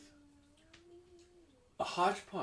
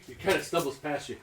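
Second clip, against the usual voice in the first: ordinary talk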